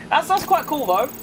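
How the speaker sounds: put-on clumsy Scottish accent for a viewer from Edinburgh; bad Scottish accent. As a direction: try a Scottish accent and miss. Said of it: "That was a horrible accent".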